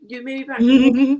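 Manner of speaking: loudly calling to her. Real, not acted. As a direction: raise the voice to a friend. Calling out to Rosie.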